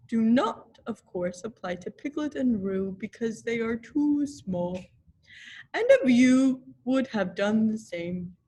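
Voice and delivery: a hint of a grand donkey speech; lightly voiced pompous Eeyore. A narrator's reading. Eeyore lecturing the guests, mildly pompous.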